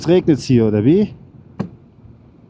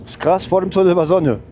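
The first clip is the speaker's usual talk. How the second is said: higher, amazed disbelief; disbelieving; 7 semitones higher than usual. Real, not acted. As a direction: sound baffled by the change. Disbelief at weather change.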